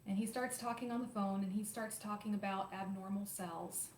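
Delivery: usual speaking voice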